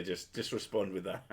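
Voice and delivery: ordinary talk, nothing marked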